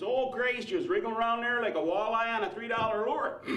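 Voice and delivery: high, broad Wisconsin lover, unsexy; Wisconsin accent. Acted. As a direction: imitate post-sex talk in a Wisconsin twang. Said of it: "with a Wisconsin accent".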